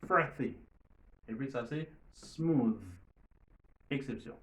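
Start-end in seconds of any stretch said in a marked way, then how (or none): 0.0-0.6 "Frothy" with an unbuzzed th, falling; voiceless th. Shown as a demonstration. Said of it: "ni non sonore". 2.1-2.8 "smooth" ending on a buzzing th; voiced final th. Shown as a demonstration. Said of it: "Mais ici".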